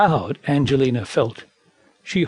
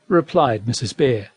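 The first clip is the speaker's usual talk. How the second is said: usual speaking voice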